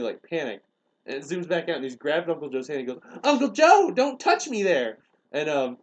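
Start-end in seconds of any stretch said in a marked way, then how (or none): 3.2-5.0 high, loud hammed-up outcry; shrieky mock protest, drawn out at the end; mock panicked kid. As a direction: shout it like an overacted alarmed boy. Kid panics, grabs uncle's hand.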